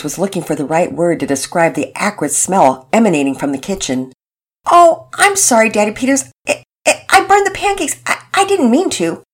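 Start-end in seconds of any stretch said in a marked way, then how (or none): 4.7-9.2 put-on high young girl's voice, flustered and sorry; apologetic girl. Penelope apologizes to Mama Peters in a high flustered voice.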